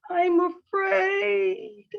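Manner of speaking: high, drawn-out mock-fearful wail; hammed-up frightened cry; afraid. Shown as a demonstration. Teacher demonstrating the face of fear to a class, hammed-up afraid. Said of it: "With the face of fear".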